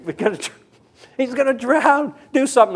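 high, urgent mock plea; panicked plea. Buddy urging the lifeguard to act, high urgent plea.

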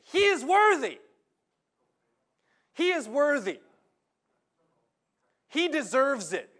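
high, loud insistent cry of the elders; acted elders loudly vouching for the soldier; mock elders' loud plea. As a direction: shout it as the elders pleading his case. Preacher acting elders' loud plea.

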